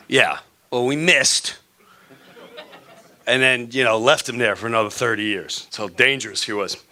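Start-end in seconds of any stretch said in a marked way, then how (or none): none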